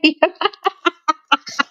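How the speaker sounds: high startled giggle; high laugh. Real, not acted. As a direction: burst out laughing high as the dog jumps up. Dog jumps on her.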